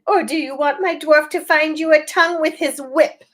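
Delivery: high cold witch threatening; high menacing witch queen. A narrator's reading. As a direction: threaten them with a sneer. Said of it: "she said again".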